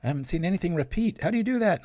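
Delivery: high-pitched mock viewer voice; imitated puzzled viewer. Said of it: "somebody emailed me. They're like".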